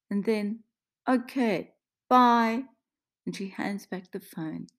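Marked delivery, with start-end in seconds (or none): 1.1-2.6 put-on raised, accented sign-off; resigned goodbye. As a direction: give up and end the call. Maria gives up, ends phone call.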